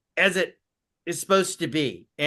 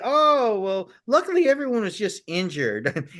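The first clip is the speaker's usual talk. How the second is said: mock cheerful; 6 semitones higher than usual. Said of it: "They didn't go and say".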